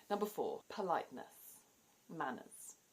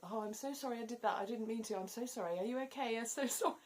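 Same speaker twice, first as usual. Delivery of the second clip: high, fast, gushing string of sorries; flustered, breaking into a laugh at the end; gushing apology; 8 semitones higher, faster than usual. Shown as a demonstration. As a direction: pile on the apologies, laughing at yourself. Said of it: "you say it a lot".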